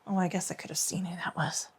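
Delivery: whispered aside; whispering. Real, not acted. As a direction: drop to a whisper. Aside about phone call.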